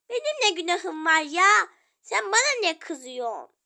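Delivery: loud, indignant complaint; loud protest. Acted. Child protesting to sibling, loud and indignant. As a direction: cry out at the unfairness.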